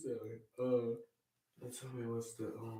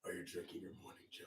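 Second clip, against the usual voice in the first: low gravelly whispered Batman; 20 dB quieter, much faster than usual. Pretend. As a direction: growl it like Batman. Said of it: "in the voice of Batman".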